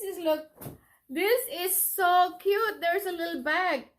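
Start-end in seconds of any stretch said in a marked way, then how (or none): none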